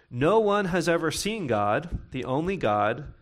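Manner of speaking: normal talking voice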